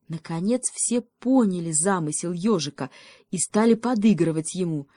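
ordinary delivery, nothing marked

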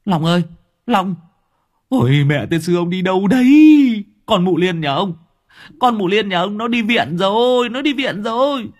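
loud high calling out; loud high yell calling the husband home. A narrator's reading. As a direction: shout across to him in alarm. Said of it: "gào to".

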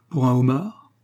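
delighted, rising question; pleasantly surprised, lifting at the end; joyful exclamation. A narrator's reading. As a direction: exclaim it with eager hope. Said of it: "s'écria joyeusement Salicorne".

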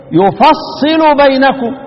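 slow يفصل بينكم as "yufassilu baynakum"; يفصل said "yu-fas-si-lu"; doubled sad with i. Shown as a demonstration. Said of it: "حمزة والكساء يقرأان إيه؟".

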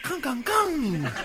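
soft nasal clonks; quiet gangoso bang effects; imitated bombs. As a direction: mouth muffled little explosions. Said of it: "Tiraban los tres tiros".

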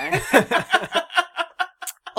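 long high burst of laughter; høy latter. Real, not acted. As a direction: laugh out loud at the memory. Lettelsens latter i gangen. (lang, høy og lys latterkule)

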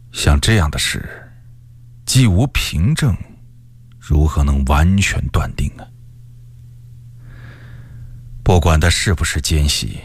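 low, measured, pondering reply; wry, rueful. A narrator's reading. Rebel king confiding doubts to his wife, ruefully. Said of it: "李自成苦笑着说".

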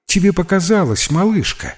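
put-on high, light voice to a child; mildly indulgent reassurance; reassuring. A narrator's reading. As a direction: talk gently down to a little one. Soothing a frightened little girl.

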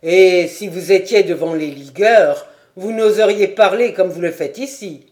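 loud, defiant rebuke; defiant lieutenant. A narrator's reading. Lieutenant defies the sergeant, loud and stern. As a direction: throw the challenge in his face.

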